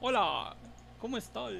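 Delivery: botched chilango sounding Chinese; singsong put-on Chinese accent; badly faked Chinese-like lilt; mock Chinese accent. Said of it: "parece que estoy imitando a un chino".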